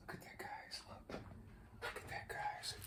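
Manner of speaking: barely audible whisper; hushed, voiceless wonder; awed whisper. Real, not acted. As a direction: whisper it to the viewers. Hushed awe at the gift.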